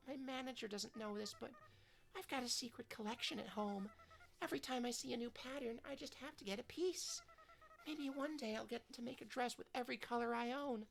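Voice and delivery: put-on sweet idol girl. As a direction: gush kindly like a gentle pop idol. Voices sweet idol character.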